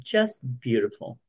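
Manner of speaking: normal talking voice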